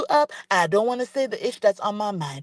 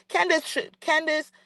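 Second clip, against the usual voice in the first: ordinary talk, nothing marked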